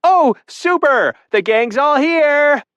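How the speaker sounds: high, loud, slow sarcastic cry; sarcastic on the edge of hysterics; naked woman greeting the crowd at the door; near-hysterical sarcasm. A narrator's reading. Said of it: "close to hysterics".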